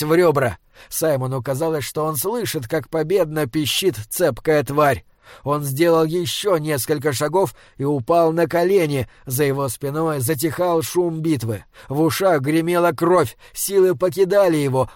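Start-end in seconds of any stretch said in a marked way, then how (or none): none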